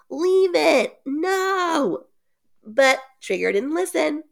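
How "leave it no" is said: high shout of warning; kids shouting. Retold or imitated. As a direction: call out sharply at the dog. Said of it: "ignored the kids shouting".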